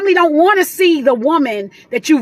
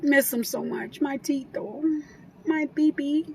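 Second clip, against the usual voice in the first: much softer, tender and wistful; softly sentimental; 11 dB quieter than usual. Real, not acted. Mother missing her Marine son, softly sentimental. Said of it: "before I get all sentimental".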